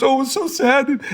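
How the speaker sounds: high, teary mock sob of a crying sister. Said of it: "and she's crying".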